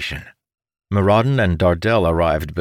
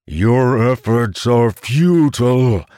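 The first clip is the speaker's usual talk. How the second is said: slow, loud booming monster voice; monster bellowing; 3 semitones higher, 5 dB louder, slower than usual. Acted. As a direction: boom it out like a giant beast. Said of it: "the monster bellowed".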